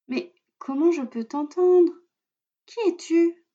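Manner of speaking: put-on a puzzled young girl; little girl voice. A narrator's reading. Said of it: "demande Lila".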